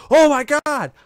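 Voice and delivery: high, loud mock-horrified outcry; retold alarm. Player reliving his game scare, loud alarmed cry. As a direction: cry out as if the monster just dropped.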